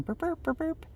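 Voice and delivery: short burping beeps like a car alarm; imitated car alarm. Honking her alarm at the knockers, short burps. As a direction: beep it out like an alarm chirping.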